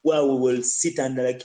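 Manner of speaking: ordinary talk, nothing marked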